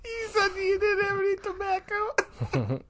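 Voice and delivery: high, slow mock wail; Monica. Caller complaining about a smoke, high drawn-out wailing voice. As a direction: whine it out like a hammed-up accusation. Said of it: "maybe it's Monica calling to tell you".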